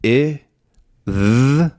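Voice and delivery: spelling out the sounds of rhythm one at a time; sounds spelled out. Shown as a demonstration. Teacher breaking a word into sounds for learners, clear and slow. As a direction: sound out each part separately, slowly. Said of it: "which can be pronounced r".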